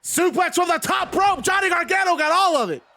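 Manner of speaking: high excited shout on the big suplex; excited call. Real, not acted. Commentator calling the top rope suplex, high excited shout. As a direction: yell it out as the move lands.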